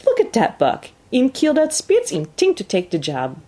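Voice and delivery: put-on gleeful French-Canadian accent, pitched high; broken English in an amused French-Canadian accent; Francois. A narrator's reading. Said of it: "slapping his thighs gleefully".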